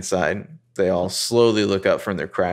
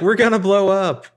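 high, loud whiny panic; hammed-up teen panic; mock panic; 11 semitones higher, 5 dB louder than usual. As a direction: wail it like doom is certain. Hannah voiced panicking in car.

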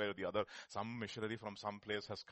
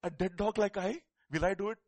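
louder and higher, scoffing; mock indignant Hazael; 8 semitones higher, 11 dB louder than usual. Hazael scoffing back at the weeping Elisha, louder. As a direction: throw the question back, offended. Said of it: "he looks at him and says".

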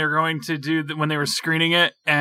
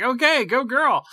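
higher-pitched mock encouragement; impressed, rooting-for-her quote; retold cheer; 9 semitones higher than usual. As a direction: cheer her on, lightly hammed-up. Retold cheer for film character.

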